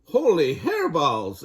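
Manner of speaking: shocked at his huge head; exclaimed. Acted. The kitty boy sees his head in the mirror, loud astonished exclaim. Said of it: "exclaimed Skippy John Jones".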